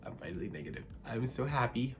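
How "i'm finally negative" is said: quiet murmured relief. Real, not acted. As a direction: lower and softer, to herself. Repeated quietly.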